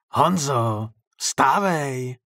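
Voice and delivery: hoarse wake-up call through a door; rough-voiced call. A narrator's reading. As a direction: call out hoarsely to rouse a sleeper. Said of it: "ozvalo se zpoza dveří chraplavým hlasem".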